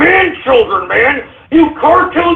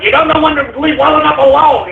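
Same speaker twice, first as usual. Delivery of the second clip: ordinary talk, nothing marked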